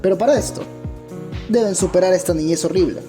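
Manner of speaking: ordinary talk, nothing marked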